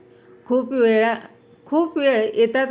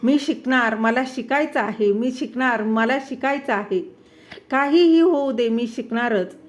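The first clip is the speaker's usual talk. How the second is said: usual speaking voice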